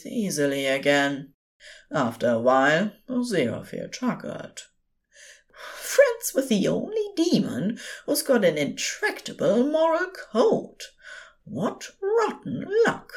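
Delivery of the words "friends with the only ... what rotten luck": high, wry teasing angel voice; fond angel. A narrator's reading. Angel ruefully teasing the demon beside him. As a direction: tease him as the gentle angel.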